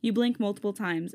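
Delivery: usual speaking voice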